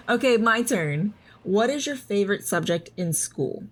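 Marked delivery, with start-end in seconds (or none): none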